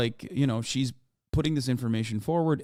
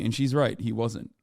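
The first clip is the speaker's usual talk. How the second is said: usual speaking voice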